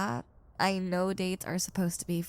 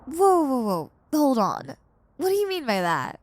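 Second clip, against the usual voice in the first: high, loud burst of astonishment; startled surprise; 4 semitones higher, 6 dB louder than usual. Acted. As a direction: stop them mid-sentence in shock. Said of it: "Of course I'm surprised".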